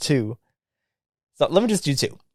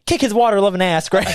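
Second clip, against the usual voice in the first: raised pitch, mock cheering from the back; heckling girlfriend imitation; 6 semitones higher, 3 dB louder than usual. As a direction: call out like an egging-on girlfriend. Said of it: "his girlfriend in the back".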